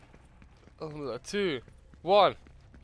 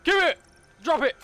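high, loud pretend hold-up demand; mock robber's shout; 10 semitones higher, 6 dB louder, faster than usual. Player robbing another in a game, shouted demand.

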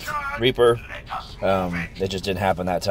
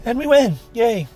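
much higher, bright victory cheer; excited cheer; 14 semitones higher, 4 dB louder than usual. Real, not acted. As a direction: cheer the win. Team wins the match.

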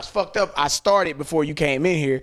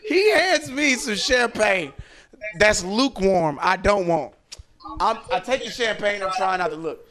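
normal talking voice

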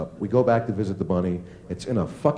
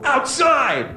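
scolding. Acted. Owner scolding dog.

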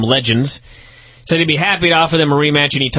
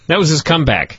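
ordinary delivery

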